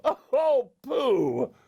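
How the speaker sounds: high-pitched laugh into a scoff; amused little mouse pooh-poohing. A narrator's reading. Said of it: "The mouse started to laugh".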